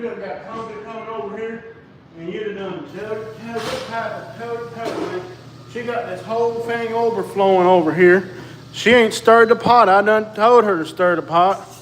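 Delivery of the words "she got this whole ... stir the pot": loud complaining. Real, not acted. Complaining loudly about the flood.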